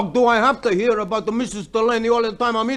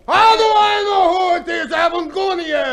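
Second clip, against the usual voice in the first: high, loud exasperated shout; irritated shout; 10 semitones higher, 6 dB louder than usual. Acted. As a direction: snap back at her. Snapping back at wife.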